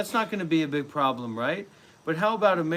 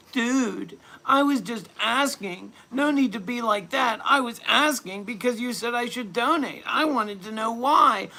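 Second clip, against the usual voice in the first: high, whiny mock of a complainer; hammed-up high whining voice; mocking whiny donor; 9 semitones higher, 4 dB louder, slower than usual. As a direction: whine it like a pearl-clutching wimp.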